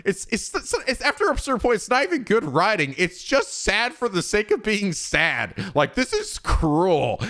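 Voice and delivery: high, slowing imitation of a friend's indignant complaint; imitating Aru. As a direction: pitch way up to mimic her protest, then drag out the end. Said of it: "She's like, she's like".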